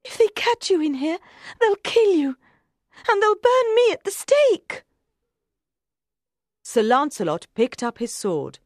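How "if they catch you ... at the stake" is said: very high, frantic queen; terrified high-pitched cry; desperate. Acted. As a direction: rise higher and louder in panic. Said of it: "Guinevere looked desperately".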